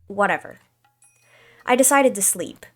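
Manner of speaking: normal talking voice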